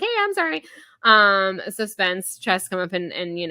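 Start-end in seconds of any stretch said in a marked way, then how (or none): 0.0-0.6 much higher hey I'm sorry; distracted apology. Layla apologizing absently to Sydney, lightly voiced high. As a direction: toss off a breezy apology. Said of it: "that she's like".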